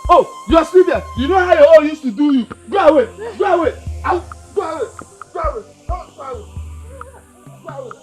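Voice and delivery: high, loud yelling. Acted. Mad son yelling to chase his mother away. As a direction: drive her off with yells.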